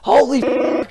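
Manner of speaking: high-pitched starstruck outburst; retold astonished exclamation; freaking out. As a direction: imitate someone amazed, voice shooting up. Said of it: "he was, like, freaking out".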